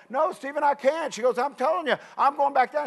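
mock pleading with a sighing gasp; gasping wife. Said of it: "And she's like".

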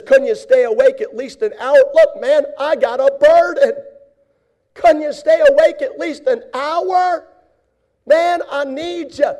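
high, loud, pained reproach; pleading shout. Pretend. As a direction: shout the complaint at sleeping friends. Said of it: "He didn't say any of that".

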